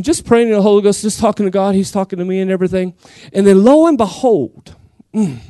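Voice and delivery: ordinary talk, nothing marked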